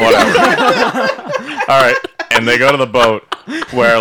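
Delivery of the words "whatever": laughing it off, much higher; giggling dismissal. Real, not acted. Laughing off a correction.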